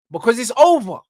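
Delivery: ordinary delivery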